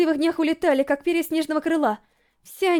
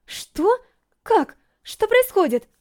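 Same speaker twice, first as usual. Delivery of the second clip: stunned questions, pitch rising higher; 6 semitones higher, much slower than usual. A narrator's reading. Racer watching her rival suddenly fall, stunned. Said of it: "Ошеломлённо подумала я".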